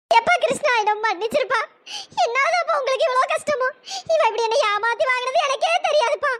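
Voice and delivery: high, tearful pleading; crying. Acted. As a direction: sob out an apology to Krishna. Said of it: "நீங்க எதுக்கு அழுகுறீங்க".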